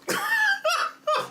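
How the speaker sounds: very high-pitched cackle; burst of laughter. Real, not acted. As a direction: crack up at your own impression. Cracks up at own imitation.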